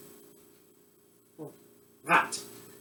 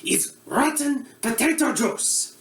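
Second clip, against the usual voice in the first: put-on comic Eastern European accent as a bumbling TV cook; broad Russian accent; mock Russian accent; 2 semitones higher than usual. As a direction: play a hammy Russian-accented chef host. Comedic Boris cooking show character.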